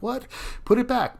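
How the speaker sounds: playful mock protest at his wife; mock outrage. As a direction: pretend to be scandalised she took it. Wife took half his sandwich.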